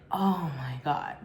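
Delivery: low, slow, unbothered mimicry; nonchalant. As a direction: imitate a boyfriend who can't be bothered. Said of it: "his response was so nonchalant".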